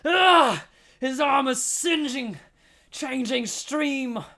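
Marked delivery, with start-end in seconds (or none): 0.0-0.7 high, loud pained scream. Acted. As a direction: scream as the lightning hits. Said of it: "Phil screamed".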